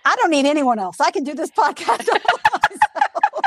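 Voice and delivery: mock boast.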